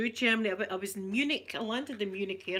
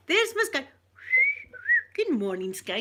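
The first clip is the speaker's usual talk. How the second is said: much higher, louder sing-song coo; cooing greeting; 13 semitones higher, 7 dB louder than usual. Real, not acted. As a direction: coo it like greeting a beloved pet. Greeting a pet by name.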